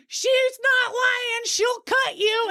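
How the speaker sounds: hammed-up drunk husband, high and slow; drunk husband warning. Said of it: "Davey's all".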